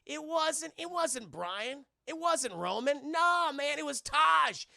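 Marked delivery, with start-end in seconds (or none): 3.1-4.7 high, loud gleeful reveal; real excitement; excited shout. Real, not acted. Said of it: "speaker excited reveal".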